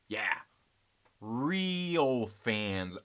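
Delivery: usual speaking voice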